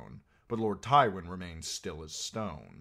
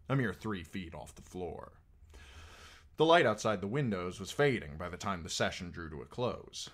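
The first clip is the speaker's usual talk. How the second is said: usual speaking voice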